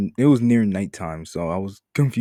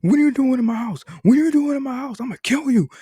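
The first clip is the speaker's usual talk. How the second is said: imitating a homeless guy screaming, much higher and louder; retold yell; 6 dB louder than usual. Homeless guy yells at kids in his house, screaming. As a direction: shriek it like a stranger catching intruders. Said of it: "just yells at us like".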